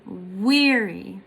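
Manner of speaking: "weary" drawn out on a strong WEA; stress on first syllable. Shown as a demonstration. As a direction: stretch and stress the start of "weary". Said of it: "the first one is stressed".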